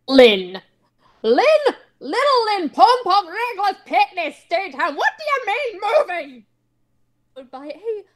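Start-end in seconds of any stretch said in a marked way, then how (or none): 0.1-6.5 high, loud, shrill panicked yell; high panicked yell at shocking family news; frantic yelling. Acted. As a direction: shout her whole name in disbelief. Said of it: "yelling like this".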